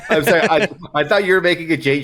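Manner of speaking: ordinary delivery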